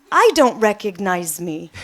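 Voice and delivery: says it through a real laugh; laughing at herself as she says it. Real, not acted. Laughing at her own confusion.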